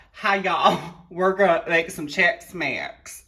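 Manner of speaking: put-on cheery Southern belle accent on "Hi, y'all"; folksy Southern drawl, mock homey; Southern lady. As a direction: greet like a sweet old Southern cook. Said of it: "old Southern women".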